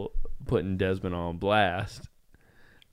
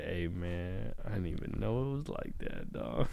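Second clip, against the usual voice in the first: quiet, low re-enacted apology; retold sheepish excuse; 4 semitones lower, 10 dB quieter than usual. Insisting to co-hosts how he apologised, soft and sheepish. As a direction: plead ignorance softly to a friend.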